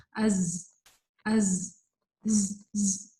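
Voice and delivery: long whistling z buzz in از; articulation check. Shown as a demonstration. Teacher checking the letter's articulation point, sustained buzzing ز. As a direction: hold the ز of از, then buzz ز alone. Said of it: "اس کا مخرج check کر لیں".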